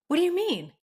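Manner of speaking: high-pitched baffled retort, imitated; shocked. Brother-in-law questioned about birth control consequences, shocked. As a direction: imitate someone taken aback, voice jumping up. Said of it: "Like, he was shocked".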